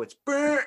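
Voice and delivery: high, loud imitated TV blast; blaring noise. Describing TV volume jump, loud mock blast. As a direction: mimic a TV suddenly blaring. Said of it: "The next thing you know".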